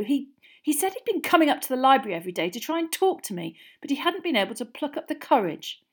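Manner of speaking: flustered rush, high and loud; very quick. A narrator's reading. Girl confessing about her admirer, very quickly. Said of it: "said this very quickly".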